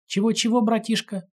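high mocking "didn't catch that"; mock not hearing. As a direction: tease like he's hard of hearing. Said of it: "театрально приложив ладонь к уху".

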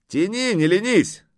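high, loud urging; shouted order. A narrator's reading. Horseman urging the gaping servant lad, shouting.

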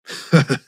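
short hearty chortle. A narrator's reading. Burly director heckling the speaker, brief guffaw. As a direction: let out a quick guffaw. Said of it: "хохотнул здоровый лысый мужик".

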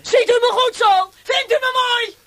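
menacing taunt. Acted. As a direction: lean in close and taunt. Torturer leans in, shows face.

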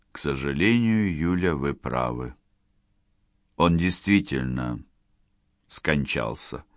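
soft, low grave news; somber doctor. Acted. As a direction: break the news gently. Doctor delivers sad news.

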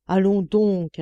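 insisting. A narrator's reading. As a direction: push back heartily. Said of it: "insista monsieur". (monsieur insiste, plus fort)

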